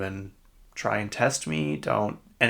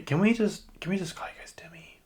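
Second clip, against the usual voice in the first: higher, dropping into a quick whisper; hushed plea; 9 semitones higher than usual. Acted. Podcaster begging to just call them Demi, hushed plea. Mock plea about the name pronunciation.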